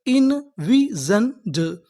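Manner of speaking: very slow, each syllable drawn out; इनविजन्ड stretched syllable by syllable. Shown as a demonstration. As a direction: sound out इनविजन्ड slowly. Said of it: "हमारी पूरी meaning क्या बनी".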